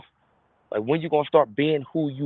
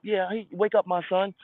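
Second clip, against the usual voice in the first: lightly voiced God; 6 semitones higher than usual. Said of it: "God wakes you up".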